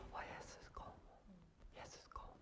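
breathy whisper of longing; whispered prayer. Retold or imitated. Heart whispering a prayer to Jesus.

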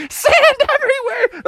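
very high, manic cackling through the words; hyena laughter. Acted. Froggy rolling on the ground, laughing like a hyena. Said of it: "laughing like a hyena".

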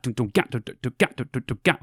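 quick do do gat drum sounds; beatboxed drum pattern. Shown as a demonstration. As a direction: tap out the drum and bass groove vocally. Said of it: "drum and bass has that".

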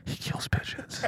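urgent whispered warning; frantic whisper. Pretend. Assistant grabbing shoulders to warn of Michael Bay, whisper. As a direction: hiss the secret in fear.

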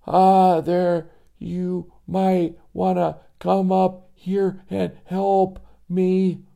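put-on frightened kid asking for help; scared boy. Joey asks for help.

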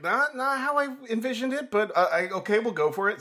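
high-pitched, hesitant giving in; strained, put-on going along with it; reluctant acceptance. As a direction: imitate a director swallowing his objections. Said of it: "Hates it, but won't say anything".

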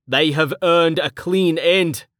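slow, grave and firm; solemn slow command. Acted. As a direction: lay down a final, heavy verdict. Man in chains demands mercy.

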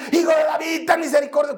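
high, insistent shouted plea; forceful shouting. Retold or imitated. Blind beggar ignoring the crowd, begging Jesus, forceful shout. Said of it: "gritaba con más fuerza".

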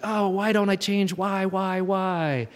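high-pitched frustrated whine, imitated; hammed-up exasperated pleading with yourself; mock frustration. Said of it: "instead of getting so frustrated".

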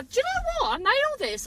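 triumphant squeal of self-praise; excited boast. Real, not acted. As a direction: burst out proudly, pitch leaping up.

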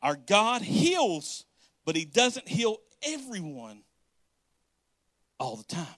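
very slow, weighty delivery on God heals; slow emphasis. Shown as a demonstration. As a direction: drag each word out for the point to land. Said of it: "y'all listen, listen, y'all hear that?".

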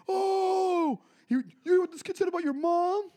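high, loud, mocking instigator voice; prankster taunt. Prankster stirring up two kids, high loud mocking taunt. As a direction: egg the kid on with glee. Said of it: "It's very funny".

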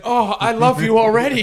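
high, very loud, hammed-up delight; mock gushing dad. Dad to his new kid, hammed-up gushing. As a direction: gush loudly with the pitch way up. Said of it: "Yeah, he's like".

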